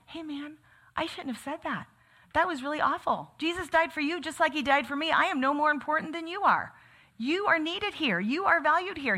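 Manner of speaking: a leader owns his harsh words; sincere apology. Pretend. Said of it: "He could have said all those things".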